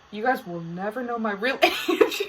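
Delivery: giggling playful secrecy; laughing tease. Real, not acted. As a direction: laugh breaking into the words. Teasing listeners about age.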